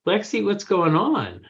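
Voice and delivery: high, puzzled parent's question; retold alarmed question. Parents to daughter throwing clothes, retold alarm. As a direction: ask it with rising pitch, mildly alarmed.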